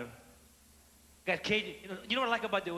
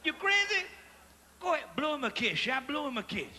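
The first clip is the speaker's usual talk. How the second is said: higher, scolding wife's voice; 6 semitones higher, 3 dB quieter than usual. Retold or imitated. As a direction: scold him like a startled wife. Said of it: "She said".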